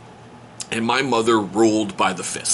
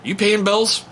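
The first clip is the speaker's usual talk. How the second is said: mock interrogation; 10 semitones higher, slower than usual. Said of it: "I'm like".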